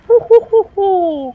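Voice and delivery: very high, loud "ouh" hoots; high whooping. Real, not acted. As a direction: whoop wildly in falsetto. Whooping during the game chase.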